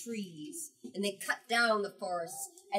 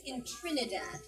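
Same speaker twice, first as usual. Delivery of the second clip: ordinary delivery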